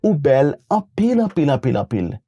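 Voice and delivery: stack up "anpil" for emphasis; emphatic repetition. Shown as a demonstration. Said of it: "you can repeat by saying".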